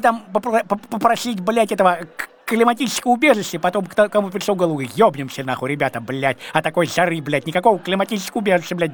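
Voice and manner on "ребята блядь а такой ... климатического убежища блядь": usual speaking voice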